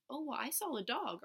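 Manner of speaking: lightly voiced little girl; a hint of a small child answering; child's voice. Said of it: "Susie says".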